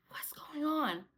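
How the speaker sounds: voiceless whisper; hushed question. Retold or imitated. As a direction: whisper it, wanting to know.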